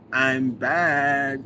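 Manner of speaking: a hammed-up "I'm back"; hyped announcement. Shown as a demonstration. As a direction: announce your return like a big entrance. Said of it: "Is that how you said it in your brain".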